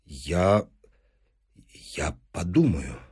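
low, soft, halting reply; breathing heavily. Acted. Professor put on the spot, faltering breathlessly. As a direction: falter with long pauses, weighed down. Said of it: "тяжело дыша".

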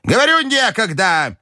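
very high, exasperated granny cry; shouting grandmother. A narrator's reading. Grandmother to the pestering party, exasperated cry. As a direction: cry out high, fed up with them. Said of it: "закричала бабушка".

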